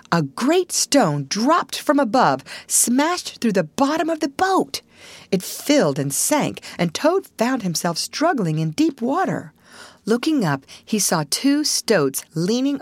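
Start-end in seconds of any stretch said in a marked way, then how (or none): none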